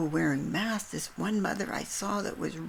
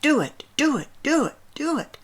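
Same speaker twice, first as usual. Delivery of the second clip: much higher and loud, pleading yell; retold desperate screaming; 9 semitones higher, 8 dB louder than usual. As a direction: scream it like a man at his wits' end. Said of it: "screaming it with such desperation".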